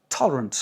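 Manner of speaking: "tolerant" with strong TOL, falling away; English "tolerant", first syllable stressed, others reduced; English reduced vowels. Shown as a demonstration. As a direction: punch TOL in "tolerant", swallow the rest. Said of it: "L'accent sur la première".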